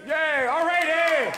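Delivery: high, loud whoop; mock Canadian cheer. Comic hailing Canada's hockey gold, mock Canadian cheering.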